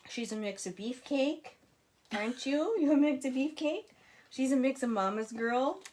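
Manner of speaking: high cooing to the dog. Real, not acted. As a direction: tease the dog fondly. Talking to the dog.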